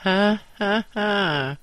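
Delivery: loud, high, hearty mock laugh; roaring laugh. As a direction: roar with laughter at the beaten boss. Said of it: "roared one".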